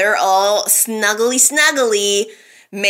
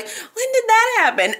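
surprised; 10 semitones higher than usual. Retold or imitated. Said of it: "I'm like".

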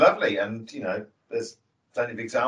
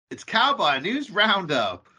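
slow, high cowboy drawl announcing; cowboy announcer; 6 semitones higher, slower than usual. Acted.

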